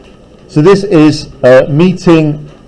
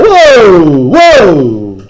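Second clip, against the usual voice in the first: mock alarm; 4 dB louder than usual. Real, not acted. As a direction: pull back in playful shock. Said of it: "Don't say things you can't take back".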